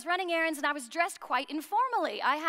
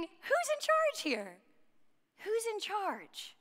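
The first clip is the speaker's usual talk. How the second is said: high, drawn-out plea for order; slow, high-pitched acted bewilderment; exasperated questioning; 6 semitones higher, slower than usual. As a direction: call it out high and slow, like someone facing mayhem. Imagined reaction to classroom mayhem.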